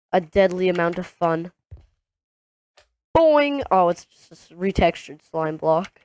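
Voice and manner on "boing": spring the sound up like a toy; cartoon boing. Imitates slime block bounce.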